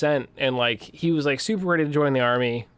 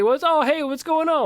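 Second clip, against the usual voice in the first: friendly stranger; 13 semitones higher, 3 dB louder than usual. Retold or imitated. Friendly stranger voiced high.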